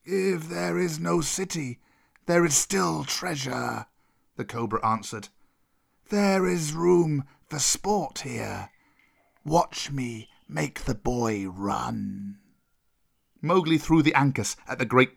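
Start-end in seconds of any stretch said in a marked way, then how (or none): 0.1-3.9 slow, drawn-out serpent voice; unhurried cobra retort; defiant old cobra. Acted. Cobra answers Kaa. 6.1-12.4 very slow serpent voice, sinking in pitch; long-drawn, cruel cobra taunt; menacing old cobra. Acted. Cobra figure in the story.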